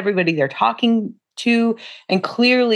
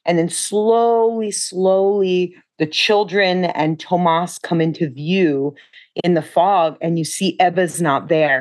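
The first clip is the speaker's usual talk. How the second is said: ordinary talk, nothing marked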